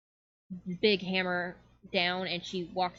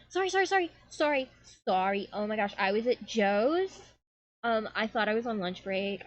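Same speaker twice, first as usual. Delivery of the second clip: flustered fairy; 3 semitones higher than usual. Acted. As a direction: scramble in apologizing over and over. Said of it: "a young woman comes scrambling in".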